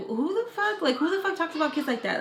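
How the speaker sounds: high-pitched, incredulous questioning; indignant disbelief. Retold or imitated. Retelling reaction to insulted kids, indignant.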